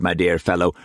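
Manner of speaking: ordinary delivery, nothing marked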